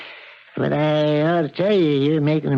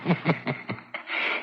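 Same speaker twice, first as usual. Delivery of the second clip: soft unvoiced sinister laugh; a quiet gloating laugh; hushed evil chuckle; villain's breathy laugh; 10 dB quieter than usual.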